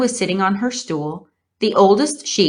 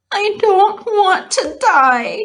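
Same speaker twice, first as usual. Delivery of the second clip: high, loud, slow tearful moan; weeping piglet moaning; 6 dB louder, slower than usual. A narrator's reading. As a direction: moan it through tears. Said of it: "he moaned".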